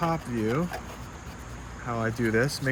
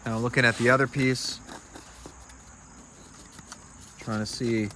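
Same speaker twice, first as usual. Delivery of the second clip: holding breath; 2 semitones lower than usual. Real, not acted. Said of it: "Sorry, I'm holding my breath right now".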